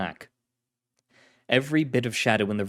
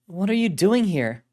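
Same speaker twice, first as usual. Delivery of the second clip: female agent voice; 9 semitones higher, 4 dB louder than usual. Acted. Carlotta voiced.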